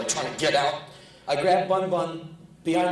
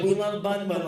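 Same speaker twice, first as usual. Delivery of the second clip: put-on high kid echo; 6 semitones higher than usual. Kid echoing mother.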